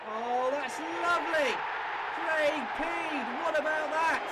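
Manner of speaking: delighted goal call. Real, not acted. First goal for Peed.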